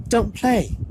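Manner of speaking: high, firm, immediate advice; quick girl's voice. A narrator's reading. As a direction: blurt it right away. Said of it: "said Hermione at once".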